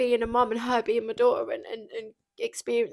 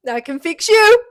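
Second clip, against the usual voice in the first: loud, high cartoon builder catchphrase; Bob the Builder; 8 semitones higher, 16 dB louder, slower than usual. Joking about a meme, she belts a cheery cartoon builder line. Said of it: "Just put my face on Bob the Builder".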